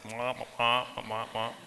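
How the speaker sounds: lightly voiced slow muffled wah-wah teacher; mock the droning cartoon teacher, slowly; Charlie Brown teacher. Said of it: "probably like the teacher in Charlie Brown".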